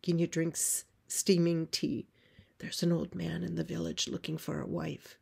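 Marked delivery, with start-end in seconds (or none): 2.6-5.2 hushed confiding whisper; voiceless, secretive tone. Acted. Farm woman confides in the girl.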